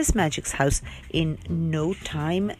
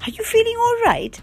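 much higher, louder question; bright question; 4 dB louder than usual. Acted. Sunshine checking on Dotty after the spell. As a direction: ask her brightly.

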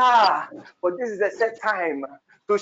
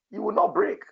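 ordinary talk

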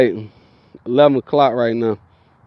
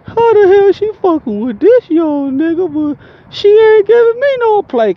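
mock jealous doctors; 4 dB louder than usual. Storyteller mimicking doctors baffled by his success with her, mock falsetto whine. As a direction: squeal it in mock disbelief, far above your own voice. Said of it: "them doctors was like".

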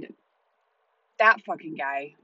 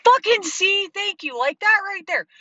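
vindicated outburst; 11 semitones higher, 4 dB louder than usual. Retold or imitated. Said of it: "I'm like".

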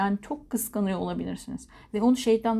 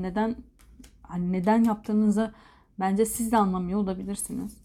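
ordinary talk

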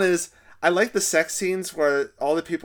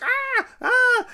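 retold scream; 5 dB louder than usual. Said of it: "She's like".

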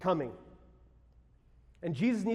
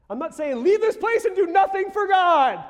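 emphatic insistence; 12 semitones higher, 9 dB louder than usual. Real, not acted. Preacher insisting to congregation.

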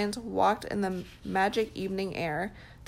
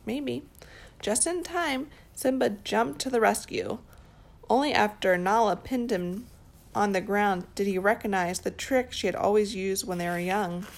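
plain reading voice

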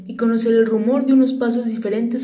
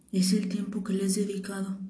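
much softer, repeating "Es el tiempo que le has dedicado" to memorise it; quiet repeating; 9 dB quieter than usual. Acted. The prince repeats the fox's words to remember, hushed. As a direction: say it back under your breath to remember it. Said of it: "repitió el principito con el fin de recordarlo".

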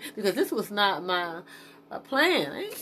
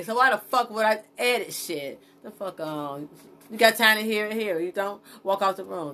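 ordinary delivery

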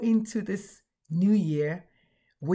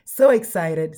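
excited; 3 semitones lower, 7 dB louder than usual. Real, not acted. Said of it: "smiling from ear to ear".

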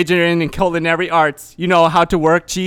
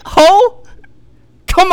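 high yelp; 12 semitones higher, 6 dB louder than usual. Pretend. High shout.

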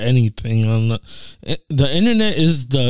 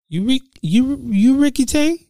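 very high, hammed-up movie voice; Rush Hour scene impression. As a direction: squeak out a comic film quote. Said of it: "the only one that I can possibly do is, um, Rush Hour Two".

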